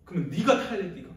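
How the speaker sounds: loud, critical challenge; acted scolding comeback; indignant retort. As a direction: throw it back at them angrily. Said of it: "비판이 나고 막".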